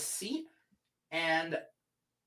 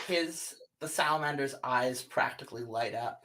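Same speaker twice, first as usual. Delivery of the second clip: usual speaking voice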